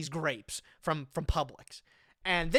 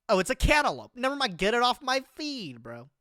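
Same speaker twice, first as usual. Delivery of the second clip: ordinary talk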